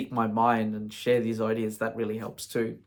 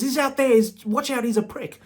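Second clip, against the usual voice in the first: urgent flatmate warning; 13 semitones higher, 5 dB louder than usual. Retold or imitated.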